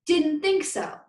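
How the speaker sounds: high, loud final scolding; teacher's sharp, loud last word; stern rebuke. Acted. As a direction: hammer home the guilt.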